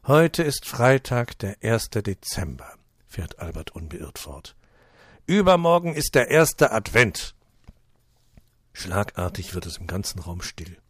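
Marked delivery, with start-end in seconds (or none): 0.1-7.4 loud, slow, weighty announcement; strong voice. Acted. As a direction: boom it out to the whole table. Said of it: "mit seiner gewohnt kräftigen Stimme". (kräftig und langsam verkündet)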